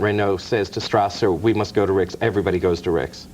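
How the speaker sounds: normal talking voice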